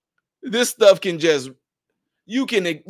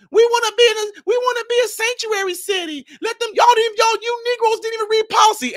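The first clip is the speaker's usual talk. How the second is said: much higher, put-on voice of eager voters; mock voter voice. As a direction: parody the voters' demand. Voicing voters wanting sanctuary city.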